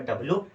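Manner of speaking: डब्ल्यू squashed to "dab-loo"; lazy two-syllable "dabloo" for डब्ल्यू; common mispronunciation. Shown as a demonstration. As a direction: drop the y from डब्ल्यू, ending in loo. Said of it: "डब्ल्यू मत पढ़ना".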